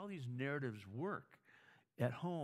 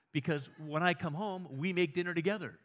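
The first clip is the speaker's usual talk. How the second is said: louder, higher insistent point; emphatic raised voice; 5 semitones higher, 6 dB louder than usual. Retold or imitated. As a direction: push it out firmly, pressing the point. Husband explains, voice rising.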